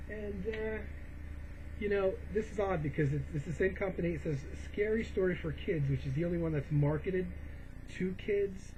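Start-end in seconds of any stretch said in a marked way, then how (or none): none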